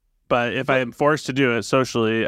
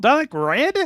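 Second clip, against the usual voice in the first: put-on much higher, childlike voice; squeaky kid begging at a candy store; 8 semitones higher than usual. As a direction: play an eager little boy asking for candy. Child role in improv skit.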